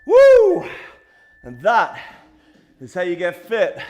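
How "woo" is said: very high loud whoop of relief; victory cheer; excited whoop. Real, not acted. End of workout cheer.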